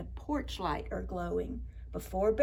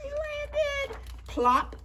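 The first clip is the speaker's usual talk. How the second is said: very high drawn-up voice, then a popped landing; playful storytime sound-effect delivery; dramatized fall; 3 dB louder, much slower than usual. A narrator's reading. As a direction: squeal it up high, then drop the plop.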